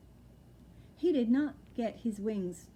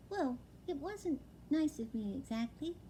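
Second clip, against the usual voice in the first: high, small owlet voice; a young owl, wide-eyed and dazed; little owlet voice; 6 semitones higher, 4 dB quieter than usual. Acted. Owlet telling his father, high and small. Plop's voice in the story.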